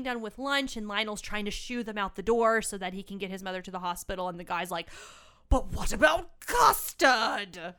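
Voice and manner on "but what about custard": loud, slow mock demand; hammed-up cry, drawing out the last word; demanding dinner guest. Acting out the guest demanding custard.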